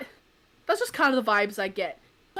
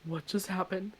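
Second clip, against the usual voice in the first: much softer, stunned murmur; 4 semitones lower, 10 dB quieter than usual. Retold or imitated. Narrator stunned after the intruder escapes, hushed. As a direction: say it under your breath in shock. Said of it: "And I was like".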